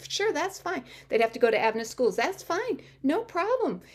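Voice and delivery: high, breezy agreeing to everything; eager agreement. Retold or imitated. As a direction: act out saying yes to any condition. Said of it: "ready to sign on the dotted line".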